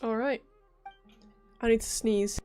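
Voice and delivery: normal talking voice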